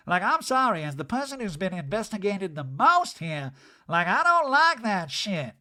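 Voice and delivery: high, loud mock Erica voice; hammed-up Erica, indignant. Recapping Erica's warrant speech in a high mocking voice.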